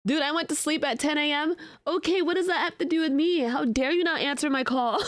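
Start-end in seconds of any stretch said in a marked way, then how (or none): none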